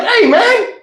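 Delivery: high call across the yard. Retold or imitated. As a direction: shout after the little brother. Calling to brother.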